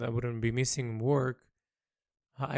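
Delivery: usual speaking voice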